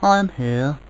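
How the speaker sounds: calling out. A narrator's reading. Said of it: "called the voice".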